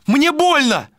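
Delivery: very high cry of pain. A narrator's reading. Malika hurt by Vilar, crying out in pain. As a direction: cry out in high-pitched distress. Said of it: "закричала она".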